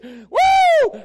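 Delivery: very high, loud joyful woo; spontaneous praise shout; ecstatic whoop. Real, not acted. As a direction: let out a holy whoop. Preacher's ecstatic outburst.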